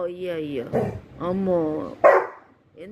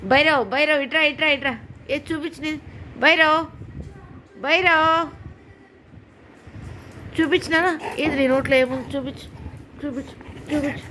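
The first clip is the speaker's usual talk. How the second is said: calling the pet; 6 semitones higher than usual. Real, not acted. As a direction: call him over, bright and insistent.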